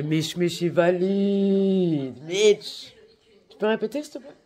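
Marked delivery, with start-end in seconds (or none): 0.0-2.9 long, loud stretched-out call to Mitch; slow, loud sing-song announcement; drawn-out call. Real, not acted. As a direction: stretch it out and call across the room. Calling out to Mitch.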